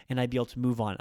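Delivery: ordinary talk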